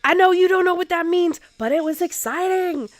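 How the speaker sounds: high, loud excited squeal. Retold or imitated. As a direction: gush with excitement. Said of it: "he was so excited".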